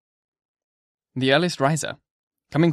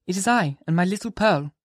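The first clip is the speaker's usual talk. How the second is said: a higher woman's voice, calm; Hester's voice; 6 semitones higher than usual. Acted. Hester answering the minister in a higher voice. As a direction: speak as Hester, evenly.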